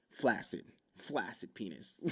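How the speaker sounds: ordinary delivery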